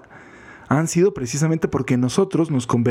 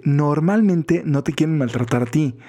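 ordinary talk, nothing marked

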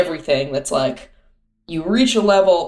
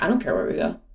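mock indifferent partner; 9 semitones lower, 6 dB quieter, faster than usual. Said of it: "every single restaurant decision is like".

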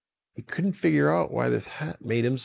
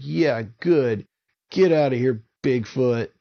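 loud, raised get-out yell; angry shout; 3 semitones higher, 5 dB louder than usual. Acted. Avon shouting Bigfoot out of the forest, angry yell. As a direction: drive him away.

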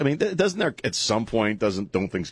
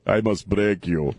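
slow, deadpan movie-villain quote; mock-menacing Rocky Four impression; Rocky Four villain line; 3 semitones lower, slower than usual. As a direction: slowly intone it like a film heavy. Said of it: "recite some of the lines from Rocky Four".

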